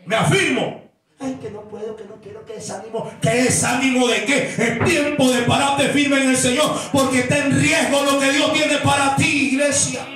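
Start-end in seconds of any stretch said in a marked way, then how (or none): 1.2-3.1 softer, whiny mock discouragement; mock whining. Preacher mocks a discouraged believer, soft whiny mock. 3.2-10.0 loud, rising sermon cadence; fiery preaching. Acted. Pastor exhorts the congregation, loud fiery preaching.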